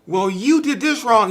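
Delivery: hammed-up finger-pointing quarrel; mock accusation. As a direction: fling blame back in a mock argument. Acting out a quarrel.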